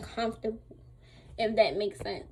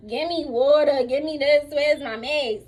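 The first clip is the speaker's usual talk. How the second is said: louder, impatient string of demands; retold irritated demands; 2 semitones higher, 5 dB louder, slower than usual. Patient in pain ordering her carers around, irritated. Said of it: "I was irritated. I was in pain".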